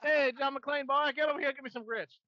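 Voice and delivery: high, hammed-up Southern call; Southern accent. Mock Southerner calls John McClane over, exaggerated Southern accent. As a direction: order someone over in a cartoon Southern accent. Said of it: "assume everyone calls people boy".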